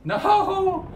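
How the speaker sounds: high, loud cry of no; real panicked shout. Real, not acted. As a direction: yell it as you get caught. Being chased down.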